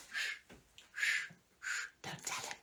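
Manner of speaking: hushed secret whisper. Real, not acted. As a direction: whisper so Mark won't hear. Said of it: "whispering to viewers".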